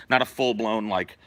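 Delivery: normal talking voice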